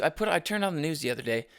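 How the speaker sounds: ordinary talk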